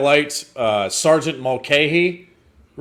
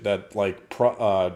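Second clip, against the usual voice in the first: ordinary delivery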